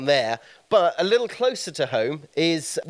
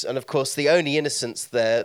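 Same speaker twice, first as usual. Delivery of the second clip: ordinary talk, nothing marked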